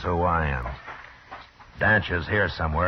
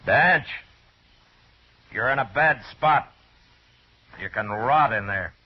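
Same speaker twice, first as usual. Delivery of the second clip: higher, hard call toward the hut; 5 semitones higher, 3 dB louder than usual. Acted. Marshal telling trapped Danch he's in a bad spot, hard raised call. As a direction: warn him he's cornered.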